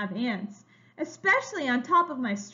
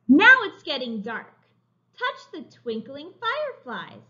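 put-on reading to children; 2 semitones higher, 5 dB louder than usual. A narrator's reading. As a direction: read it like a picture book for little kids. Reading a picture book aloud.

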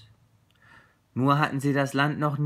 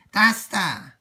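loud, high crow announcement; 7 semitones higher, 8 dB louder than usual. Acted. Crow showing the friends the land, crow voice. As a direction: point it out as a proud crow. Crow's voice in the story.

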